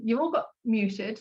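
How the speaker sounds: ordinary delivery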